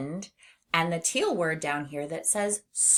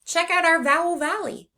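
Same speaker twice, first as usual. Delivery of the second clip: excited; 11 semitones higher, 5 dB louder than usual. Real, not acted. Excited announcement.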